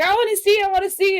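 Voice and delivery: high, eager pleading; excited. Retold or imitated. Voice actor begging to see the pictures, excited. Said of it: "like, all excited".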